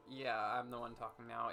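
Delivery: ordinary talk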